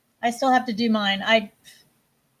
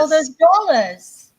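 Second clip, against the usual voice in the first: mock Australian accent; 2 semitones higher, 6 dB louder, slower than usual. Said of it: "It's not dollars".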